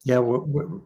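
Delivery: ordinary talk, nothing marked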